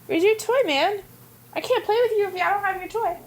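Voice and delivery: high-pitched pet talk; high doggy-talk voice; talking to a puppy. Real, not acted. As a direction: chat playfully to a dog, pitched right up.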